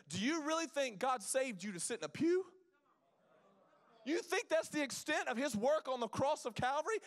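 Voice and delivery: impassioned pulpit challenge; full-force preaching. Real, not acted. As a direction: press the congregation with pointed rhetorical questions.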